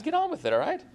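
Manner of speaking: high, eager get on with it; impatient urging. Pretend. Preacher urging the love story along, high and impatient.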